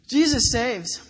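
proclaiming. Real, not acted. Preacher's proclaimed climax.

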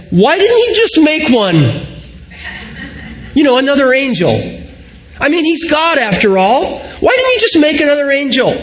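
high-pitched, exasperated challenge; impatient. Retold or imitated. Grieving Becca speaks up to the group, impatient and high-pitched. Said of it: "you can kinda see the impatience rise".

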